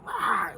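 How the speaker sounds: loud, high mock snarl; sudden loud dog-noise yell; imitated dog bark. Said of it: "that dog's just over there".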